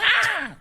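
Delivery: high, loud mock scream; short shrill-pitched imitation scream; Brock Lesnar scream. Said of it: "like Brock Lesnar when he screams".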